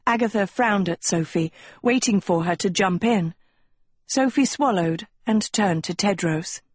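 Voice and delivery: plain reading voice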